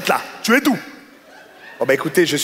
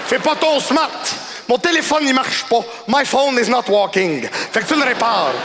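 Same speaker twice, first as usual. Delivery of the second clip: high, barking customer tirade; hammed-up angry client, much higher; mock angry yelling; 8 semitones higher than usual. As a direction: yell at the clerk to fix the phone. Customer yells at clerk.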